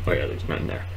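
usual speaking voice

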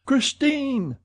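high, drawn call of a name; crying out. A narrator's reading. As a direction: call out desperately to her. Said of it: "cried Monsieur de Chagny".

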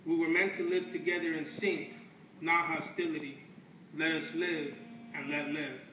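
measured, deliberate poetry reading with weighty pauses; spoken word poem. Acted. Performing a poem on stage for an audience, in a measured spoken-word delivery. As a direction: let each line land like a verse. Closing solo poem lines.

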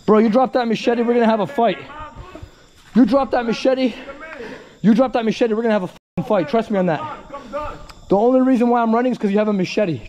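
angry challenge. Real, not acted. Challenging a machete man to a fight, angry shouting. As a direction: dare him to drop it.

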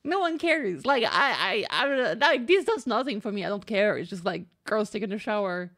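ordinary delivery

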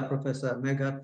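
ordinary talk

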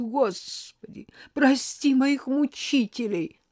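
hammed-up deathbed prayer; dying martyr's plea. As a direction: plead slowly like a stage martyr. Grandmother fakes dying agony.

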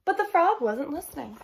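ordinary delivery, nothing marked

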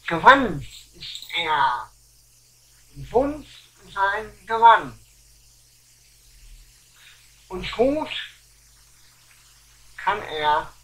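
slow, loud, declaimed verse; poem recital. Acted. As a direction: recite deliberately, with long pauses between phrases. Recites own humorous poem.

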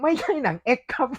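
high-pitched mock protest; startled protest. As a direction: correct them in a high, taken-aback voice. Acts out startled correction to parent.

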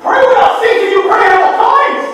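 loud, fervent preacher exhortation; preaching shout. Real, not acted. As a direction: preach it out with force.

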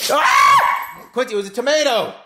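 high startled exclaim; surprised shout. Real, not acted. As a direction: shout in shock at the pick. Shocked at the dog's pick.

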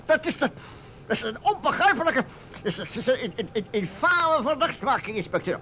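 high, stammering indignation; sputtering outrage. Acted. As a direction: splutter in shocked offense. Burgemeester sputtert, beledigd. (hoog, stamelend en verontwaardigd)

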